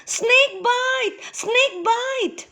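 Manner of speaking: put-on very high frightened child's cry; crying little girl. A narrator's reading. Little girl running to her mother, high frightened cry. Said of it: "cried a little girl".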